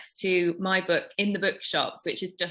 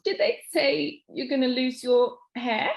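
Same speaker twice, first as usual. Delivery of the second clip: slow, higher, tearful question; fighting back sobs; 7 semitones higher, slower than usual. Acted. Best friend asking through held-back sobs, tearful. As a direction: hold back the tears as you ask. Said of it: "she fights back the sobs".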